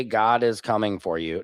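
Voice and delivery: usual speaking voice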